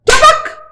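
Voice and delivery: high snapping bite; sharp snap sound. Acted. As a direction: snap like jaws.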